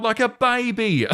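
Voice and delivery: slow, high, sneering jab; mocking scorn. Real, not acted. Mocking the mother's tantrum, slow and sneering.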